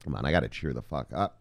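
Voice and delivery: normal talking voice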